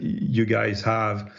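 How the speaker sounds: ordinary delivery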